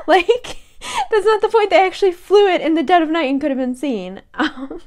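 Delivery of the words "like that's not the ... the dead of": high, emphatic exasperation; exasperated again. Retold or imitated. Mum insisting to husband, high and emphatic. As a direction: insist it firmly.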